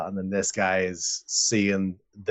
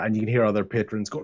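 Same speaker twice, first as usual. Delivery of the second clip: normal talking voice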